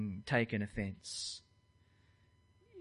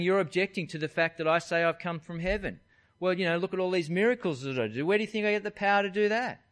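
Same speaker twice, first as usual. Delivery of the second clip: louder, fast, heated debating; arguing the toss; 6 semitones higher, 6 dB louder, faster than usual. Pretend. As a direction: push back hard and fast to win the argument. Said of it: "launch in and argue the toss".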